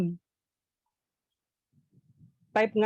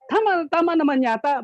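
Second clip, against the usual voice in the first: higher, louder amused reaction; excited reaction; 10 semitones higher, 6 dB louder than usual. Real, not acted. Storyteller reacting to the punchline, higher and louder. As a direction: react to your own joke.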